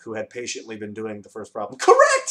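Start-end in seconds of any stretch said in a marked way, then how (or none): 1.8-2.3 very high, loud exclamation; shouted. A narrator's reading. The Dodecahedron shouts his approval to Tok, loudly. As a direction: cry out in triumph. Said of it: "shouted the dodecahedron".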